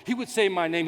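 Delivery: ordinary delivery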